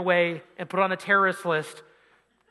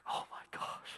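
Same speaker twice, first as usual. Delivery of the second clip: panicked whisper; 18 dB quieter than usual. Retold or imitated. Dreading the cop's request.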